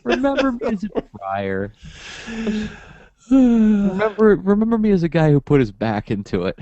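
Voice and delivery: high, mock-tearful plea with laughter creeping in; wimpy epitaph voice. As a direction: ham up a pitiful last request. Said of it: "would put a different voice behind that".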